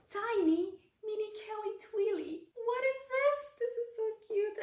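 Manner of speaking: high, delighted gushing over something cute. Real, not acted. Showing viewers the tiny Twilly, delighted high gushing. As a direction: coo over it like a tiny treasure.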